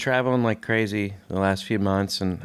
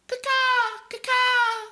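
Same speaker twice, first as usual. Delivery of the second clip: high bird caw; much slower than usual. Acted. Silly sign-off bird calls.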